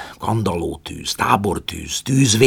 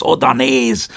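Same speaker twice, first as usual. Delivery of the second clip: loud, higher shout; excited call; 8 semitones higher, 8 dB louder, slower than usual. A narrator's reading. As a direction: call out pointing. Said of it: "kiáltott Prométeusz". (izgatott kiáltás)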